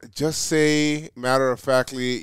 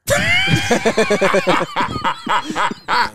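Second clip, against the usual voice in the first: long, higher-pitched real laughing fit; 7 semitones higher than usual. Real, not acted. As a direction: laugh hard and keep it going. Cracks up at the bedroom line.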